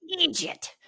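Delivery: loud, high attempt at an Irish accent; hammed-up, unconvincing Irish accent; bad Irish accent. Shown as a demonstration. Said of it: "you don't sound Irish at all when you say that".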